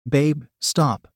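usual speaking voice

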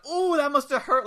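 high, loud exaggerated sympathy; mock wincing. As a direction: imitate a showboat's fake wince at someone's pain. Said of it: "he does, like, the".

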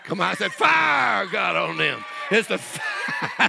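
high, loud shout with drawn-out "fire"; slow, stretched, shouted proclamation; full-force preaching. Real, not acted.

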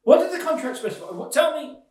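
high, loud, incredulous questioning; exasperated demand. Acted. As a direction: demand an answer from a useless business partner. Quarrel over unread contract.

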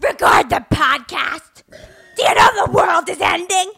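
high, strained mock shriek; imitating mom yelling. Daughter mimics mom refusing to record the podcast, yelling. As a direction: yell it like an outraged mother. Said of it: "Why are you yelling?".

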